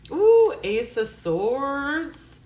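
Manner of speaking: thrilled, drawn-out discovery; excited reveal. Real, not acted. Reader to viewer, thrilled as the card turns up.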